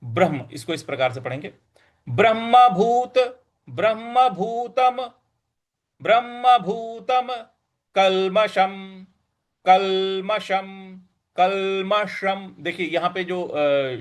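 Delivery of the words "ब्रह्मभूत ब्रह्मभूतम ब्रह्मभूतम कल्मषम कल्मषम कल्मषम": कल्मषम slow with retroflex ष, tongue curled back; drawn-out कल्मषम with a curled ष; slow careful drill. Shown as a demonstration. Teacher drilling कल्मषम slowly for students. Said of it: "तब श बोलना है".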